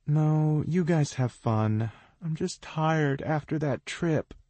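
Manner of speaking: lightly voiced fake yawn; fake tired. A narrator's reading. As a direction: pretend to be sleepy, a bit muffled. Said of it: "her words muffled by a very fake yawn".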